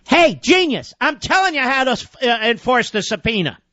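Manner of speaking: high-pitched, scornful jab; exasperated heckling. Real, not acted. Host heckles chairman over subpoena, exasperated and scornful. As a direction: talk to him as if he were clueless.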